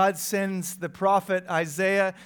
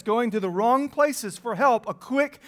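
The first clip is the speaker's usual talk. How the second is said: ordinary talk, nothing marked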